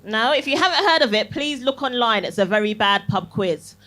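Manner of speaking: ordinary talk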